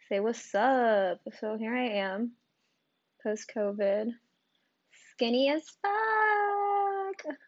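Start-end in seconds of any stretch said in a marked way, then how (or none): 5.1-7.4 high, slow put-on squeak; mock squeaky voice. Host mocks her own thinness in a high silly voice.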